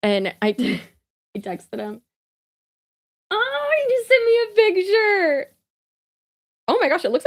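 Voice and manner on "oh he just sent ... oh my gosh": much higher delighted squeal; excited squeal. Real, not acted. Sister seeing her brother's tattoo photo, squealing excitedly. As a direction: squeal with real joy at the photo.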